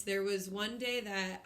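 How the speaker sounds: ordinary delivery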